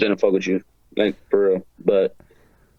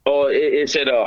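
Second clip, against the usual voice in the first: normal talking voice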